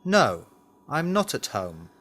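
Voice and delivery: usual speaking voice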